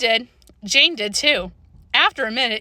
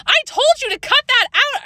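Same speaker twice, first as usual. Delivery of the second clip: high-pitched, fed-up shout; angry shout; 4 dB louder than usual. A narrator's reading. Girl orders Jane to stop throwing planes, angry shout. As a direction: shout it high, at the end of your patience. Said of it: "I shouted".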